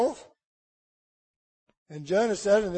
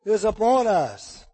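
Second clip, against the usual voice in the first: loud, fervent preaching; full-force sermon delivery; 3 semitones higher, 7 dB louder than usual. Acted. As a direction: drive the story home to the congregation. Preacher retelling Jonah at full force.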